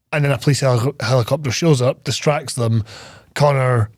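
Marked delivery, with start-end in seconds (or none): none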